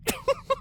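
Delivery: high little giggle; stifle a giggle behind a hoof; tittering laugh. Acted. Twilight giggling at Rainbow's reading, tittering. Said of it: "Twilight tittered".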